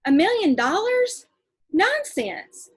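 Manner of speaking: incredulous. Acted. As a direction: scoff at the absurd sum.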